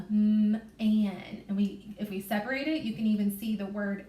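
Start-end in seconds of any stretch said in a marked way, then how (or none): 0.1-1.4 M held apart from an; segmented M-an. Shown as a demonstration. Teacher to class, sounding out man again. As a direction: stretch the M, then join an. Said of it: "So now I hear that M".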